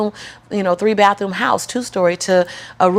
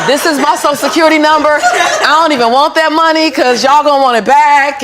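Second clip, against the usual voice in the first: higher, louder, laughing protest; laughing disbelief; 5 semitones higher, 7 dB louder than usual. Retold or imitated. Telling the teller the money isn't hers, laughing protest.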